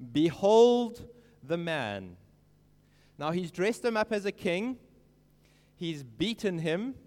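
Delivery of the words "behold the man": loud and slow, presenting him to the crowd; a big slow behold; loud slow proclamation. Retold or imitated. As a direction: announce it loudly and slowly. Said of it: "And Pilate said to them".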